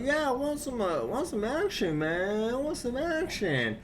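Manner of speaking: high goofy voice begging for action; mock eager guy. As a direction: squeak it out like a thrill-seeking goof. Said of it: "He's like".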